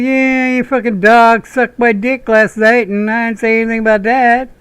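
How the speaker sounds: high, sarcastic comeback, slowed; mock retort. Answering the fan with a crude high retort. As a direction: fire back a crude high joke. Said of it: "I'm like".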